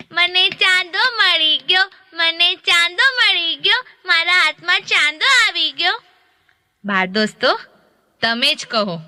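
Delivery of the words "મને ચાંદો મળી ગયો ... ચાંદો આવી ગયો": put-on higher, bright happy child's cry; joyful child. A narrator's reading. Baby Ram clapping with joy at the moon in the mirror, high and excited. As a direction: clap and cheer like a delighted little boy. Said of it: "Ram તાળી પાડીને બોલવા લાગ્યા".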